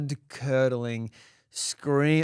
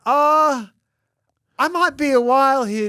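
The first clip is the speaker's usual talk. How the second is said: higher, louder retold call; awkward apology; 10 semitones higher, 8 dB louder than usual. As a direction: call out to someone waiting. Addressing waiting dog owner, retold.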